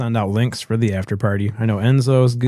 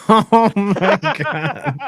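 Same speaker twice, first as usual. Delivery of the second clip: slow words through laughter; laughing; 10 semitones higher, much slower than usual. Real, not acted. Cracking up at the joke.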